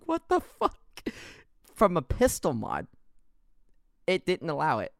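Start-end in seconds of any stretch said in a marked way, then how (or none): 0.0-0.8 high-pitched, laughing disbelief; incredulous retelling. Retold or imitated. Player recounts a pistol mod crashing the game, high-pitched incredulity. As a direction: squeak it out in mock disbelief.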